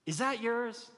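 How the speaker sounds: high, loud call across the parking lot; a receipt checker catching you, mildly hammed up; store checker calling out. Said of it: "you hear this voice".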